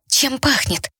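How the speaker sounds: put-on girl's voice. A narrator's reading. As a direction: ask it as young Phoebe. Phoebe asks, high.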